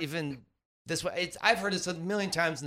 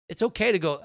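ordinary delivery

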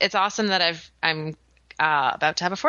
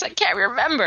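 normal talking voice

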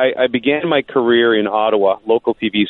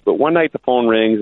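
ordinary talk

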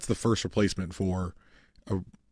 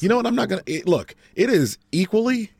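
excited; 5 semitones higher, 5 dB louder than usual. Real, not acted. Heated football prediction argument.

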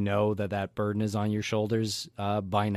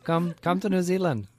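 much higher, louder put-on New Zealand accent; hammed-up mock New Zealand accent, pitched way up; joke Kiwi accent; 9 semitones higher, 4 dB louder than usual. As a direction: ham up a New Zealand accent to tease Lance. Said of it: "Irish or".

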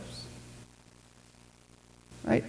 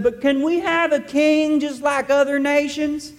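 put-on whiny, higher childish plea; sniveling little child; 7 semitones higher than usual. Preacher mocking Israel begging God for a king, whiny child. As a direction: whine it like a spoiled kid begging. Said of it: "like a sniveling little child".

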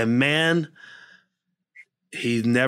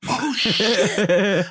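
laughing oh shit; 6 semitones higher than usual. Retold or imitated. Stunned reaction in retold scene.

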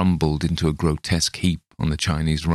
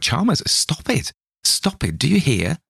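higher urgent pleading; crying out; 10 semitones higher than usual. Acted. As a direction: shake him back to sense. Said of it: "I cried".

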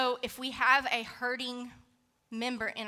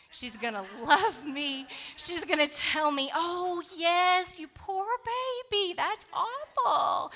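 mock sympathy; 7 semitones higher, 4 dB louder than usual. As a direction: coo over her like a pitying grandma. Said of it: "she's gonna give me sympathy".

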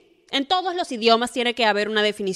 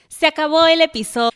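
mock announcement; 6 semitones higher, 7 dB louder than usual.

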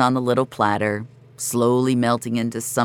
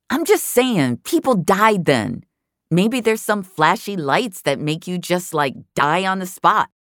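Tara, insistent; 7 semitones higher than usual. A narrator's reading. Tara argues with Jamie in chat, higher insistent voice. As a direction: dig in and insist she's right.

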